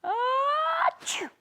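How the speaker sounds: loud high acted sneeze; big sneeze. Storyteller sneezing for the fish. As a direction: throw the whole body into an achoo.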